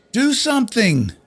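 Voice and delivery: high, loud, desperate scream. A narrator's reading. Loyce screaming at his staff, high and loud. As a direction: scream it at the store. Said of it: "he screamed".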